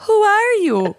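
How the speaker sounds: high dramatic question; playful spooky challenge; mock dramatic.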